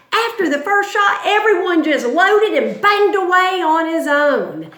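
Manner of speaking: put-on old soldier's account. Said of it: "This is a dirty shirt talking".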